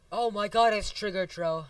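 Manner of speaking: loud, high exclamation; excited recognition. Real, not acted. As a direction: shout out on spotting a friend. Spots a friend in game.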